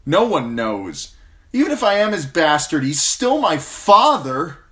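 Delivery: loud, fast, rising anger; bitter outburst. Acted. Jon insists his father still matters, shouting bitterly. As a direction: let it burst out. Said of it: "Jon said bitterly".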